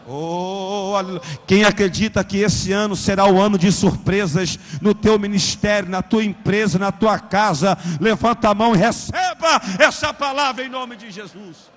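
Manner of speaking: loud, rising call to the congregation; preaching. Acted. Preacher calls the church to raise hands, loudly. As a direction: preach it out, full force.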